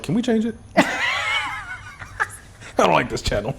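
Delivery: high child imitation, fairly quiet; little nephew. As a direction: mimic a small kid asking. Nephew's request quoted back.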